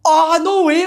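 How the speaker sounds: high drawn-out "oh"; retold surprise, pitched right up; confused realisation. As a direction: act out the penny dropping. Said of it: "I was well confused".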